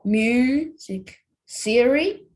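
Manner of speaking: slow, leaning on "music" and "theory"; stressed beats. Shown as a demonstration. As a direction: stretch and punch the strong beats of "music theory". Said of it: "the strong beats would fall on I".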